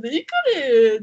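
a tearful plea, half-laughed; laughing mock whine. Quoting her tearful reply to him, mock whine. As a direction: whine it out as if still teary, giggling. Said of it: "泣いちゃったもん".

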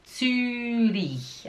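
ZÜRICH with a long, pouted Ü. Shown as a demonstration. Teacher demonstrating for learners, drawn-out pouted Ü. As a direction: stretch the first vowel of "Zürich" through rounded lips. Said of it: "tem que fazer esse biquinho".

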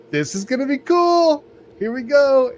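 gleeful about a reckless trip; giddy excitement. Retold or imitated. Man with broken toe gleeful about driving ten hours, high and loud. Excited before long drive.

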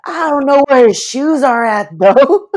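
high, loud mock friend voice, slow on look who I found; Will showing off the lost friend, high mock voice; imitating Will. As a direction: sing it out high like a proud drunk buddy. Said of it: "Will was all like".

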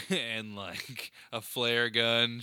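ordinary talk, nothing marked

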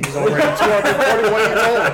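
loud, high, laughing protest; laughing loudly. Real, not acted. Player joking about their elderly character, laughing loudly. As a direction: blurt it out through real laughter. Table cracks up over character's age.

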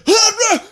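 loud, very high gibbering cry; panicked startle. Retold or imitated. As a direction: wake up shrieking in mock panic. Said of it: "panic attack looking thing".

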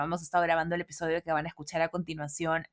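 usual speaking voice

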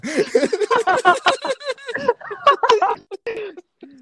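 loud, high, long cackle; burst of laughter. Real, not acted. Host laughing at his own apology, loud laughter. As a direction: let a big laugh roll out. Guest's reaction to old apology.

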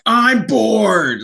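high, loud mock heckle; hammed-up crowd complaint; bored crowd heckler. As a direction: call out like a restless audience member. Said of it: "the crowd just like".

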